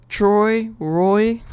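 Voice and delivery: "Troy, Roy" loud, Roy rhymed with Troy; rhyming pronunciation. Shown as a demonstration. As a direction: say "Troy, Roy" as an exact rhyme, evenly spaced. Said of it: "like that".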